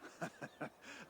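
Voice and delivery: a quiet breathy chuckle, rising; amused little laugh; soft laugh. Acted. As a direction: chuckle at the friendly jab. Teased about his new manner.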